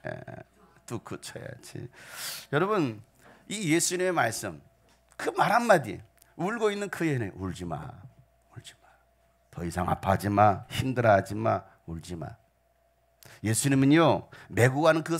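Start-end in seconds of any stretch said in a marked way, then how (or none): 0.9-1.9 put-on soft whisper to a crying child; hushing whisper. Shown as a demonstration. 7.3-12.3 gentle comforting. Acted. Jesus comforting the widow.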